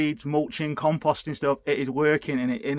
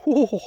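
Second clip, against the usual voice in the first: sour wince; 11 semitones higher, 8 dB louder than usual. Real, not acted. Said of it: "They're sharp".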